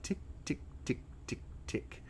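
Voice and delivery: whispered tick tick ticking; ticking clock. Retold or imitated. Mimicking a clock to TikTok users, whispered tick tick. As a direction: tick off the seconds like a clock, under your breath.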